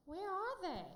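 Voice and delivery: mock-bewildered call; puzzled where are they. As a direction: look around, baffled they're not there. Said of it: "it was like".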